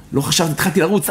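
normal talking voice

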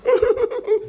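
high, broken wail of mourning; imitated sobbing over the dead; wailing sobs. Shown as a demonstration. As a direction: sob in short high bursts. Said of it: "הוא בוכה על המת".

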